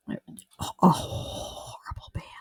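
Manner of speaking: slow, breathy whispered jab; whispered aside. Acted. Host whispering a dig at Nickelback, slow whisper. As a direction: hiss it like a secret insult.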